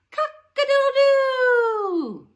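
loud, high, slow crowing; rooster crow. Shown as a demonstration. Teacher crowing like a rooster to the kids. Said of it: "a rooster says".

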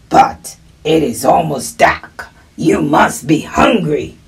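put-on voice of a helpful wolf. Wolf reassuring a lost little one, creature voice. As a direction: play the wolf talking to a child.

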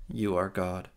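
ordinary delivery

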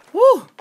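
high, loud whoop of victory; triumphant woo. Real, not acted. Gamer landing a hard parkour jump, loud high whoop. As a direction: whoop as you land it.

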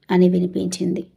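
ordinary delivery, nothing marked

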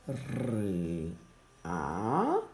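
naming each letter slowly and clearly. Shown as a demonstration. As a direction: spell out R and A slowly for children. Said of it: "formează împreună silaba". (rostire lentă, literă cu literă)

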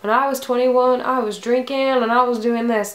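loud, hammed-up Southern know-it-all; mock the critics in a broad Southern accent; bad Southern accent. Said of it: "I don't know why my southern accent's in".

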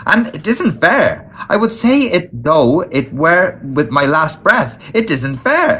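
high, aggrieved wail of injustice; indignant protest. A narrator's reading. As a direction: protest like a wronged child, pitch leaping up. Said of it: "His proud heart was nearly bursting".

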